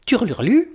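high chirpy budgie trill; sing-song bird twitter; birdsong trill. Acted.

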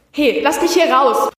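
acted shout to be let out; trapped man's acted shout. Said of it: "brülle ich".

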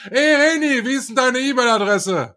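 high retold call across the room, laughing; retold studio yell. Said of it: "hab ich durch das Studio gebrüllt". (lachend quer durchs Studio gerufen)

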